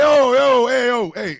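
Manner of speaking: loud, high mock call. Calling out to the mayonnaise guy, loud and high. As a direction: shout yo at the guy to stop him. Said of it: "I said".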